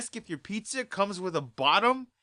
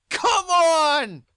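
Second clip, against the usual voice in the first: high, loud cry of disbelief; exasperated shout; 10 semitones higher, 8 dB louder than usual. Real, not acted. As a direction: throw your hands up and shout it. Exasperated climax of rant.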